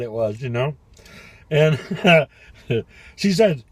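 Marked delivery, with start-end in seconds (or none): none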